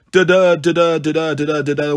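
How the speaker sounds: da-da chanted with the beat on the second syllable; sneering da-DA da-DA rhythm read; mocking iambic beat. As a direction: tap out the iambs in a mocking singsong. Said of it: "what sort of beat is that".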